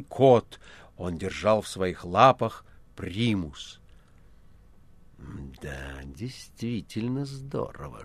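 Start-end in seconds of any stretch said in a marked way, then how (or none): 5.6-8.0 slow, hushed, soft whisper. Acted. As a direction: breathe it out in awe. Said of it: "шепнул один из пришедших".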